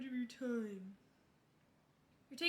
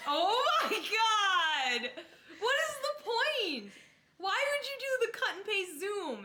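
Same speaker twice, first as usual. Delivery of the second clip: high, loud, laughing disbelief; exasperated laughing; 10 semitones higher, 9 dB louder, slower than usual. Real, not acted. Outraged by lazy panel.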